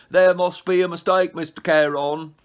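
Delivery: high, loud mocking whine of a panicked soul; mocking a dead soul's frantic plea; mock pleading. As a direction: ham up a desperate protest. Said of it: "No screaming, no".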